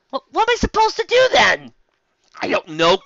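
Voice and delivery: high, loud exasperated cry. Acted. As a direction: throw up your hands in the voice. Exasperated author.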